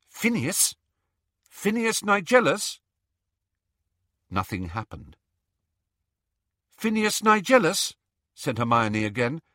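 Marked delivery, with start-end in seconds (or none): 0.1-2.8 high, tentative call. A narrator's reading. Calling to an empty portrait. 6.8-7.9 high, louder repeated call; insistent calling. A narrator's reading. Calling again, no answer yet.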